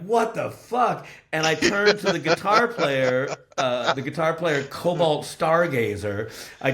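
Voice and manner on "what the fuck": high, slow, disbelieving; stunned disbelief. Retold or imitated. Stunned bandmate watching the singer smash the bar, disbelieving. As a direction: let the shock stretch out. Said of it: "I'm just sitting there like".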